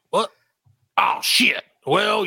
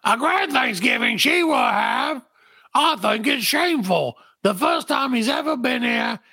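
put-on much higher, sing-song young woman teasing; high girlish voice; 8 semitones higher than usual. Ada mocking grandfather about being thankful, high teasing girl's voice. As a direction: tease grandpa sweetly, pitched up high.

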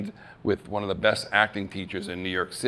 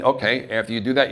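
ordinary talk, nothing marked

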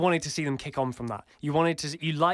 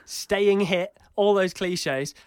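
normal talking voice

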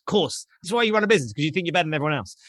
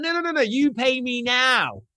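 high, drawn-out mock insistence; insistent demand; 7 semitones higher, slower than usual. Winner demands his money on the spot, insistent. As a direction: refuse high, then stretch the demand out.

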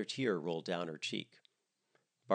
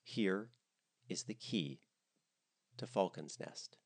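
softer, grave and measured; solemn; 6 dB quieter, slower than usual. Acted. A mother passes her daughter the inn key, softly solemn. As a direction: hand it over like a sacred heirloom. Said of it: "she said solemnly".

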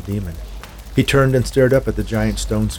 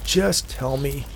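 pleading; 4 semitones higher, much slower than usual. A narrator's reading. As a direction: draw the words out as if begging. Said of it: "Hank pleaded".

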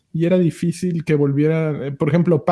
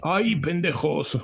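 villager yell imitation; 7 dB quieter, slower than usual. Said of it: "cómo gritaban esos güeyes".

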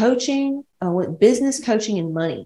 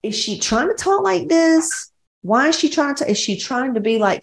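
mock incredulous; 7 semitones higher than usual. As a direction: pitch it up in judgy disbelief. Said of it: "I was like".

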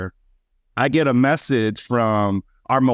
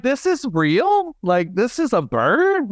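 high, slow, incredulous questions; acted disbelief; 9 semitones higher, slower than usual. Birder acting out seeing the spoonbill, incredulous. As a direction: stretch it out in mock amazement. Voicing astonished first bird sighting.

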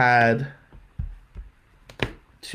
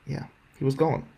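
ordinary delivery

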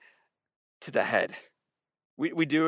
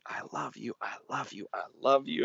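a father soothing his burned son; tender father; 2 semitones higher than usual. Retold or imitated. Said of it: "my dad said".

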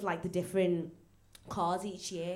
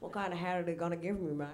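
put-on softer, fast mumbled Australian accent; redone Australian accent; 3 semitones lower, 8 dB quieter, faster than usual. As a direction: redo the Australian bit, low-key and quick. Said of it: "Do that accent again".

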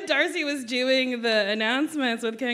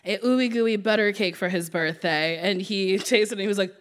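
usual speaking voice

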